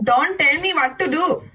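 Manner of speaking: "Don't tell me what to do" with retroflex t sounds in a Korean accent; "me" stressed, in a Korean accent. Shown as a demonstration. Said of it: "Pronouncing English words in Indian and Korean accent".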